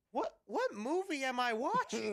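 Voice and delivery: mock bewilderment, pitched right up; baffled disbelief. Viewer reacting to the twentieth crude joke, baffled and incredulous. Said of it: "I'm just like".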